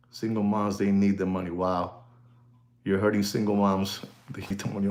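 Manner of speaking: soft, whiny mock of the critics; mocking whine. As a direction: sneer at them in a small, soft voice. Mocking critics about single moms.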